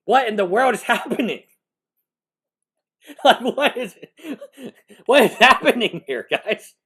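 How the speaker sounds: can't believe it, laugh through the words; incredulous laughing outburst at the lawyer; disbelieving laugh. Real, not acted. Streamer reacting in disbelief.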